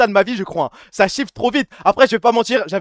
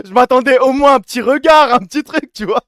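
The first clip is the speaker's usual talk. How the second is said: laughing hard, higher-pitched; cracking up; 4 semitones higher, 3 dB louder than usual. Real, not acted. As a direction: burst out laughing between the words. Reacting to a clip, cracking up.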